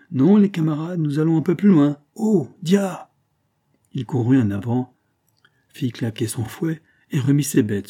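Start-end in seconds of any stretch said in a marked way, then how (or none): 0.1-3.1 raised, higher carter's call; high, loud driver's command to the team; calling to horses. A narrator's reading. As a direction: hail the horses and steer them on. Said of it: "Il s'interrompit pour interpeller ses chevaux".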